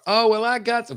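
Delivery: high-pitched, loud put-on reply; mock Kamala Harris. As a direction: answer the staged question in a hammed-up high voice.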